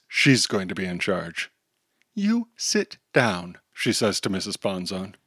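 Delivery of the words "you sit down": higher, slow, controlled; firm command. Acted. Girl ordering the teacher to sit, slow. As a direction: take charge coolly.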